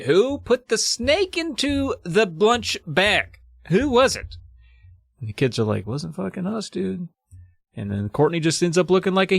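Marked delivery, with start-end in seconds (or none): 0.1-4.4 high, drawn-out mock teacher's demand; hammed-up high-pitched interrogation; stern interrogating teacher. Teacher voiced interrogating the class. 5.9-7.1 sheepish denial. Acted. Kids denying blame to teacher.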